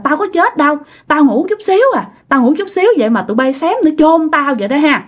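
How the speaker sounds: high-pitched, quick grumbling protest; reproachful. Acted. Revived father complains they nearly buried him, reproachful. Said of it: "trách móc".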